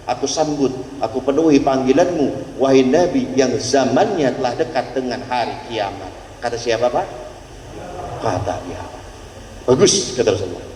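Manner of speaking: usual speaking voice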